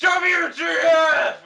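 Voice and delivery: high, slow hammed-up furious yell; angry shout. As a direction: blast it out in mock outrage. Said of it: "I shouted angrily".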